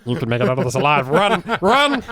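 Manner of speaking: fast, frantic, then shouting; urgent panicked escape cry; panicked goblin. Acted. Goblins panic, eyes go wide.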